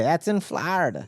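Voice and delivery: a hint of grandma's way of talking; imitated grandma. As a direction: quote grandma with her squashed "Florida". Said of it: "As my grandma would say".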